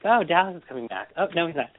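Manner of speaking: high, quick giddy voice; excited self-quote. Retold or imitated. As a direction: squeal it like excited gossip. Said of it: "every week I've been like".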